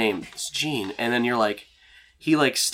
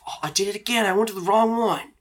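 high, flustered character voice; acted embarrassed outburst; embarrassed man character; 11 semitones higher, 4 dB louder than usual. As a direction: pitch it way up, mortified. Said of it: "he looks so embarrassed".

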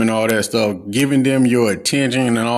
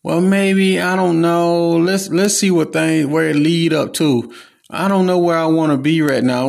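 higher, dodging voice of a hoeing partner; mock the wishy-washy excuse, pitched up; stalling ex, voiced higher and unsure; evasive ex; 6 semitones higher than usual. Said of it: "watch what they tell you".

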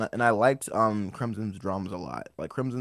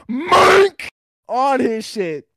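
hyped shout; 9 dB louder, much slower than usual. Retold or imitated. Retold fight, hyped.

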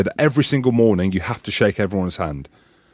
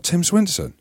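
disbelief; 8 semitones higher than usual. Retold or imitated. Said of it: "I was like".

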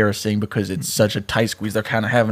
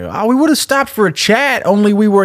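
high, sing-song cheeky lad; cheeky boy; 9 semitones higher than usual. Acted. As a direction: answer the cop with airy cheek, pitched up. Cheeky boy answers the cops.

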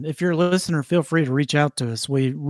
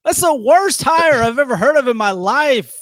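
louder, higher indignant rant; exasperated outburst; 7 semitones higher, 5 dB louder than usual. Real, not acted. Co-host berates his friend's hire, exasperated. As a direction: let rip in indignant disbelief. Outraged at co-host's coach pick.

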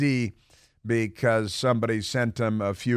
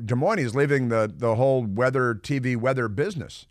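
normal talking voice

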